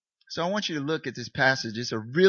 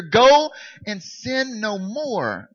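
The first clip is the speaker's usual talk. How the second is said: slow emphatic quote; 7 semitones higher, 8 dB louder, much slower than usual. Retold or imitated. Quoting Jesus, drawn out.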